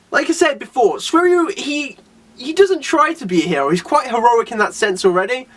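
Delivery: normal talking voice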